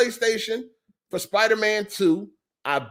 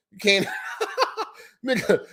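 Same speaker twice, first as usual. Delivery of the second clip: laughing. Real, not acted. Laughing at the name.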